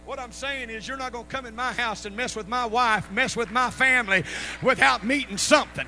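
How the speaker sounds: rising into loud, forceful preaching; building preaching. Real, not acted. Preacher builds toward full force.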